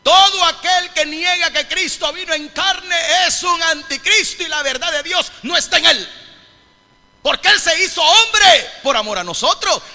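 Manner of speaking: high, shouted pulpit proclamation; loud, impassioned preacher; full-force preaching. Real, not acted. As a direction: hammer the message out to the congregation.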